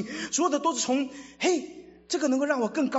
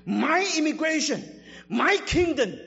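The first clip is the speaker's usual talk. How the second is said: loud and insistent, listing what is mine; loud mock protest; 6 semitones higher, 4 dB louder, slower than usual. Preacher voicing a selfish believer, loud and insistent. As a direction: proclaim each possession loudly and defiantly. Self-centred believer's inner voice.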